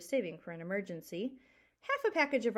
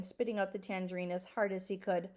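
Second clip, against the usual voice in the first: usual speaking voice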